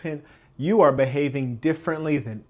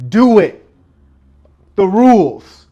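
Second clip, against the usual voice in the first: louder, higher punch on each word; preacher urging the crowd to break the rules, shouting; emphatic shout; 4 semitones higher, 10 dB louder than usual. Real, not acted. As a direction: hammer the point home. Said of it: "urging listeners to break rules".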